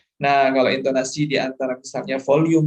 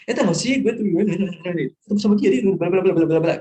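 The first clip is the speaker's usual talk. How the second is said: higher-pitched, rapid excited gossip; fast happy chatter; 4 semitones higher, 4 dB louder than usual. Shown as a demonstration. Teacher demonstrating how happy people talk faster, excited rapid chatter. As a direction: gush it out, tumbling into blahs. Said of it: "lebih cepat ketika mereka senang".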